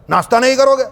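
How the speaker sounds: high, loud Haryanvi offer; a Haryanvi host offers snacks, loud and blunt; Haryanvi bluntness. Retold or imitated. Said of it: "એ લોકોનું બોલવાનું એવું".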